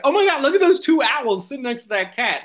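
high-pitched, acted amazed exclamation; excited teen bystander. Thirteen-year-old filming two owls beside a cat, excited. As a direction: gush it like a kid filming a viral find.

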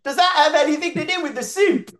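high, put-on little British accent. Shown as a demonstration. [name] asks in his British accent on request. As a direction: squeak it out in a prim British accent. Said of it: "can I hear an impression of that".

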